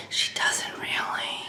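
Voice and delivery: breathy whisper, almost silent; hushed aside under the breath. Real, not acted. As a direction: drop to a whisper for the jab. Whispered aside about social worker.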